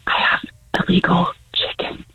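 hushed, halting confession; real nervous whisper. Real, not acted. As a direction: whisper it like a secret. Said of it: "hopefully then I stop whispering".

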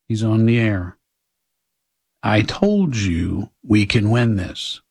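usual speaking voice

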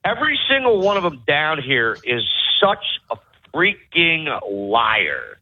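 ordinary delivery

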